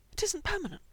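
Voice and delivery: high, pleading insistence; cling to hope, desperate; desperately. A narrator's reading. Frank insisting to Mikey it won't last, desperate high plea. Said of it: "he said again, desperately".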